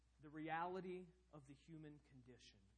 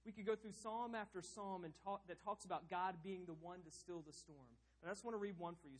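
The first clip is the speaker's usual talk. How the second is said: ordinary talk, nothing marked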